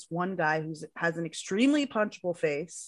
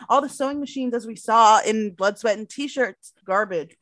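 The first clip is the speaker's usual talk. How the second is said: ordinary delivery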